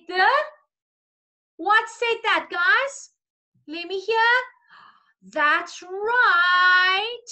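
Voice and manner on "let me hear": normal talking voice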